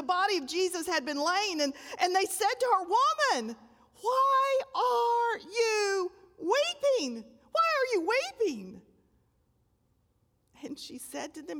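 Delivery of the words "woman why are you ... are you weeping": high, slow, aching angel's question, voice crying; drawn-out, tearful heavenly plea; tender angel voice. Acted. Angels voiced asking Mary.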